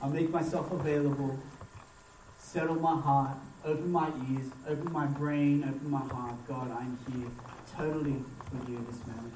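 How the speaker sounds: low, soft prayer in short phrases; model prayer. Acted. Pastor offering listeners words to pray to God, quietly prayerful. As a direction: lead them gently through a personal prayer. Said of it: "pray for yourself".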